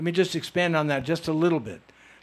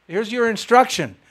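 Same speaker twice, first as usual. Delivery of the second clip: louder, higher, beaming mock orders; gleeful instructions; 6 semitones higher, 7 dB louder than usual. Telling the audience his wish to die in kirtan, joyful loud orders. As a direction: lay down the rules with delighted glee.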